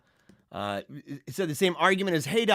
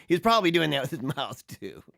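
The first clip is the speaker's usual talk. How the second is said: usual speaking voice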